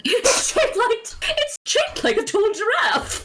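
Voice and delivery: posh high voice. Pretend.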